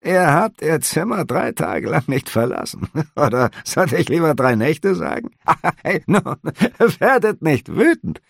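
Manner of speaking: high, laughing sly voice; teasing innkeeper. A narrator's reading. Innkeeper mocking the officer's three days, laughing. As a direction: needle him with a grin.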